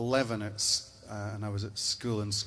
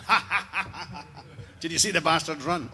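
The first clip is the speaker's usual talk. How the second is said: big, loud, higher mock belly laugh, then a boast; gloating Viking laugh; 7 semitones higher, 5 dB louder than usual. Drunken Vikings at the feast gloat over the fleeing enemy, booming mock laughter. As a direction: roar with laughter like a victorious warrior at a feast.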